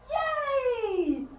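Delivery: long, high yay; gleeful cheer. Acted. Cheers as frog hops over.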